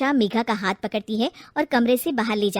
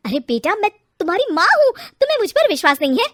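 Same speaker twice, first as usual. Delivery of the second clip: hurt pleading; 7 semitones higher, 8 dB louder than usual. Acted. Mother pleads with son.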